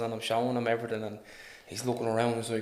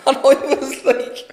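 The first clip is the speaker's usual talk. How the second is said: high, slow laughing words; breaking up with laughter mid-story; 13 semitones higher, 11 dB louder, slower than usual. Real, not acted. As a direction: squeeze it out through laughter. Laughing hard.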